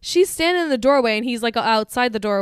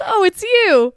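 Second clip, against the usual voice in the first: mock recognition; 8 semitones higher, 7 dB louder, slower than usual. Acted quote of startled Tracy.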